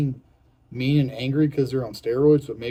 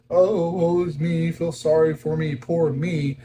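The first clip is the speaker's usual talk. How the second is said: slow, whiny woe-is-me wail, higher and louder; mock self-pity; 5 semitones higher, 4 dB louder, slower than usual. Dieter mocking self-pity to viewers, slow whiny wail. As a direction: ham up feeling sorry for yourself. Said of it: "I'm not saying this to be like".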